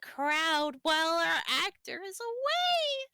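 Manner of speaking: hammed-up theatrical voice. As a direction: declare it like a hammy stage actor. Mock theatrical announcement.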